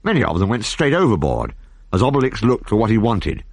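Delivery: usual speaking voice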